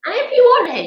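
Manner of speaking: ordinary talk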